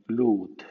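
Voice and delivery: "loot" with a long oo; plain long U. Shown as a demonstration. Teacher shows U after L, long oo in "loot". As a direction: say "loot" as loot, no y-glide. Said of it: "suena U".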